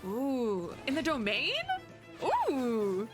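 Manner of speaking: ordinary talk, nothing marked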